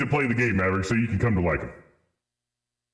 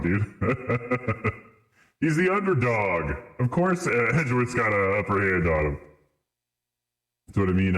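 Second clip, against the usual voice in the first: usual speaking voice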